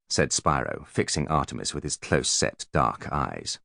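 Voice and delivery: ordinary delivery, nothing marked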